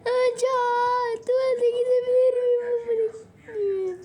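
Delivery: put-on high, loud child asking the teacher; whiny student. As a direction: squeal the request to the teacher. (öğretmene tiz ve yüksek sesle soran öğrenci)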